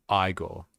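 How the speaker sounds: first syllable of Igor rhymes with "my"; alternate pronunciation. Shown as a demonstration. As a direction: say Igor as EYE-gore. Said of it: "I thought it was pronounced Igor".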